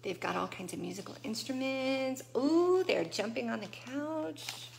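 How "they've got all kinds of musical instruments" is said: ordinary delivery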